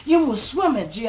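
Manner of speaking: high, loud mock shout; insistent friend. Friend insisting in retold exchange.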